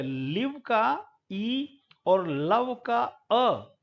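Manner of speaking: slow demo of लिव with ई and लव with अ; contrasting vowels. Shown as a demonstration. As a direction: draw out each vowel to contrast them. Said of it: "दोनों अलग-अलग sound हैं". (ई और अ को धीरे और अलग करके बोलना)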